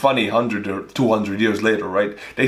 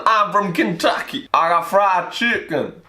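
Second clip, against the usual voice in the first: exaggerated Kentucky Southerner; mock Southern accent; 5 semitones higher than usual. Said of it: "southern state of accents".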